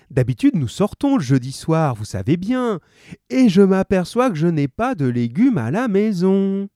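casual shop talk as the wife; lightly voiced housewife. A narrator's reading. Mary speaks to grocer.

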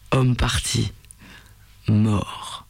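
low, rocky voice from deep in the throat; a heavy creature, grave and halting; slow cavern rumble. A narrator's reading. Creature answers the knight.

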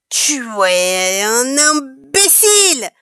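slow, indignant yell; slow furious royal outburst; queen's furious yell. A narrator's reading. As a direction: hurl the insult at the sorcerer like a furious queen. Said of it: "hurla la reine".

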